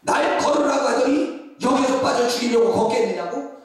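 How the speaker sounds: loud, high indignant complaint; hammed-up indignation as a sinking disciple; mock protest. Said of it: "베드로는요".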